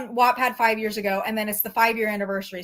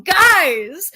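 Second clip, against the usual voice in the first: loud, higher call to friends; acted urgent call; 8 semitones higher, 8 dB louder than usual. Callum alerting his friends to a fight, urgent. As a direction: call out as if rushing in with news. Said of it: "Callum panted".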